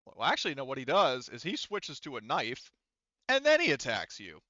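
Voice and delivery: ordinary delivery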